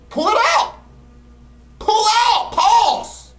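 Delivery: high frantic shouts; panicked yelling. Real, not acted. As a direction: scream in panic. Panicking as item won't work.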